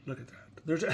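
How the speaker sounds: ordinary delivery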